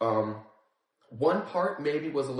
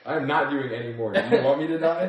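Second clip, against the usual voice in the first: laughing protest; 4 semitones higher, 7 dB louder than usual. Real, not acted. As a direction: laugh through the words. Laughing refusal to eat more.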